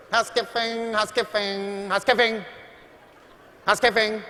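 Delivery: high, sing-song housekeeper call through the door. Retold or imitated. Mocking the maid knocking on his door, high chanting call. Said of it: "she's going".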